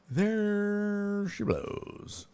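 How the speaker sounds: high, soft, very slow call; mock lookout's singsong cry; drawn-out lookout call. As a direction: stretch it out like a distant whale sighting. Put-on lookout's call on finding figures.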